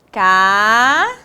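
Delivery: "Casa" split into long rising "Ca", pause, "sa"; syllable by syllable. Shown as a demonstration. Teacher dictating as pupils write, "Casa" in separated syllables. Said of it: "Separe-as em sílabas".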